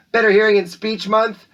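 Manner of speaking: title announcement. Acted. Announcer proclaiming the month's theme to students. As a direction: proclaim it like a headline.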